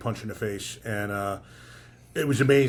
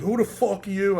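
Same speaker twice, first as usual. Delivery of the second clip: retold angry yell; 9 semitones higher than usual. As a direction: imitate an aggressive guy confronting you. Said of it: "I hear a guy yelling".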